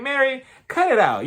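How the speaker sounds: high-pitched mock telling-off; exasperated scolding. Viewer telling pissy Mary off, high exasperated scolding. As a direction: scold an absent person in playful exasperation.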